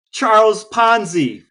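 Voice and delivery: high, loud, slow name call; quizmaster announcement. Pretend. Co-host quizzing host on grifters, game-show announcement.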